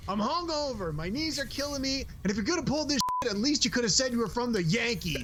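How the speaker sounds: high, put-upon complaining voice; grumpy hungover player. Retold or imitated. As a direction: whine like a sore, hungover veteran. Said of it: "He goes".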